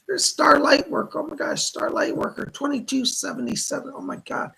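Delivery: high-pitched, thrilled surprise; amazed donor shout-out. Real, not acted. Host reacts to Star's large donation, amazed and excited. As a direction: gush in real amazement, voice well up.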